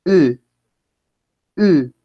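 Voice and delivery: each E said as "ü"; U umlaut vowel. Shown as a demonstration. Teacher models the sound for class, isolated rounded E. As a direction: lips set for U while saying E, twice. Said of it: "pronunciar una U, pero pronuncian una E".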